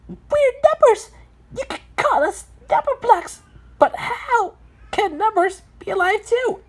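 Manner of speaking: very high cartoon voice; number block character. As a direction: introduce yourself in a high, squeaky character voice. Number character introduces its kind.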